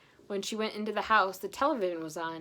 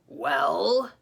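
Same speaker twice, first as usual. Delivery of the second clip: gruff bull; 4 semitones higher, 9 dB louder than usual. Acted. Huge bull answering door.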